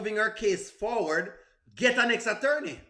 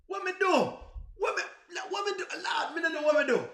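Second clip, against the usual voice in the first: high, pleading what-do-I-do; exasperated cry; 8 semitones higher than usual. Real, not acted. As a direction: throw hands up in disbelief, voice jumping higher. Exasperated at chat accusing him.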